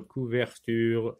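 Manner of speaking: ordinary delivery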